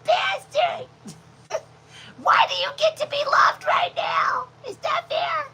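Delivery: high, shouted mock outrage; mad dog. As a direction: shriek the jealous dog's indignant complaint. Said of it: "She mad. She like".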